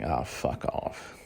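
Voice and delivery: whispered and slowed, under the breath; breathy slow mock dismissal; muttered fuck off. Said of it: "It's like".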